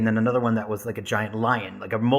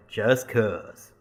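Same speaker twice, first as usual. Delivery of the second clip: deep Southern drawl. Retold or imitated. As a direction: repeat it back in a low Southern drawl. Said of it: "really deep Southern drawl".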